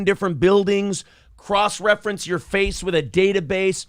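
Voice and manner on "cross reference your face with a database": ordinary talk, nothing marked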